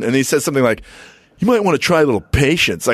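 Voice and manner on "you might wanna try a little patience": higher, put-on Chemo voice; imitating Chemo. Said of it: "don't give him that voice ever again".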